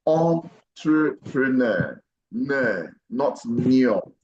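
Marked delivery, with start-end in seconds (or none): 0.1-2.0 "entrepreneur" said slowly, beginning "on"; slow model, entrepreneur. Shown as a demonstration. Teacher models the French-origin word slowly. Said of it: "So what we have here is".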